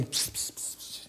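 secretive "psst"; hushed, unvoiced psst to get attention; quick furtive whispered hiss. Acting out a secret whispered message.

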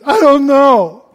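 loud, high-pitched helpless confession; hammed-up desperate driver pleading ignorance; throwing oneself on mercy. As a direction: wail your cluelessness to the officer. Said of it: "I just threw myself".

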